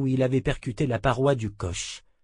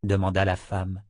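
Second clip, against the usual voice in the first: usual speaking voice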